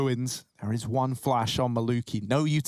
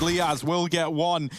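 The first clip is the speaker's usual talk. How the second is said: ordinary delivery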